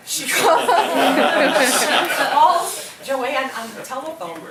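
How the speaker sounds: loud, high, laughing through the words; can't hold back the laughter telling it; laughing while telling. Real, not acted. Telling friends how the stranger phoned the mother, laughing.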